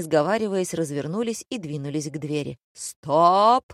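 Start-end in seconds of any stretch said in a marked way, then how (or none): 2.7-3.6 loud, stretched stop; shouted order. A narrator's reading. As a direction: halt everyone with a shout. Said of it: "заорал Барашков". (громкий протяжный окрик)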